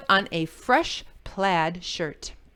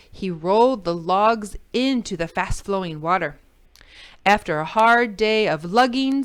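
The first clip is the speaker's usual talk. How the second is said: ordinary delivery, nothing marked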